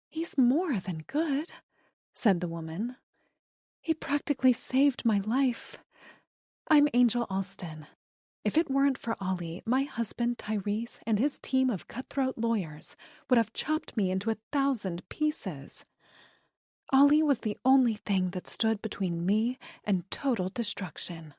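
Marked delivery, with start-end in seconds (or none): 0.1-1.5 gushing woman voice. Acted. Angel's voice. 3.8-21.3 dramatic gushing woman. Acted. Angel's voice, dramatic.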